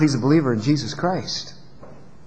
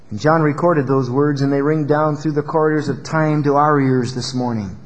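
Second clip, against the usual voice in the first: usual speaking voice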